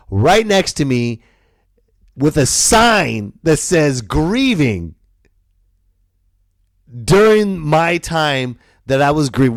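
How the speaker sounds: high, loud, building to awe then fading; amazed disbelief. Real, not acted. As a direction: marvel out loud at the odds. Disbelief at the church sign.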